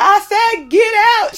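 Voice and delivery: high, loud comic order; imitated angry yell. She orders crying girls out of the house, mock fury. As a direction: yell it like a mom at her limit.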